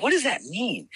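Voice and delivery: fed up with the same phrase; exasperated. Retold or imitated. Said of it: "And I was like".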